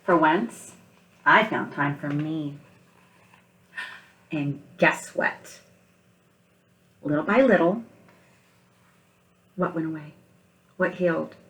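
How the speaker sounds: picture-book read-aloud, gentle and unhurried; story narration. Acted. Teacher reading picture book aloud.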